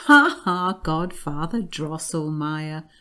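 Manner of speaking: loud read-out "ha, ha" that settles quickly; laughing interruption. A narrator's reading. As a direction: burst in with acted laughter. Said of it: "interrupted him with loud laughter".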